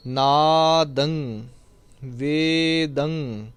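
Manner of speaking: ਨਾਦੰ and ਵੇਦੰ slowly, each ending in a nasal ng; slow, falling ਨਾਦੰ, ਵੇਦੰ with the ng held; tippi pronunciation. Shown as a demonstration. Teacher drilling ਨਾਦੰ and ਵੇਦੰ, slow with nasal endings. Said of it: "ਟਿੱਪੀ ਦਾ ਜੋ ਉਚਾਰਨ ਹੈ".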